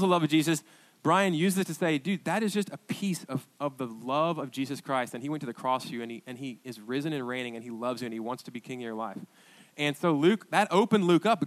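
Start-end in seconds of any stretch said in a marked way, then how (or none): 2.0-9.2 gentle reassurance. Retold or imitated. Brian comforting guy on phone.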